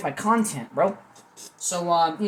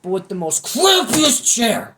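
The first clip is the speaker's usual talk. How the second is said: mock outrage; 6 semitones higher, 8 dB louder, slower than usual. Real, not acted. Complaining about his squeaky chair.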